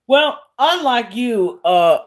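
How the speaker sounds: ordinary talk, nothing marked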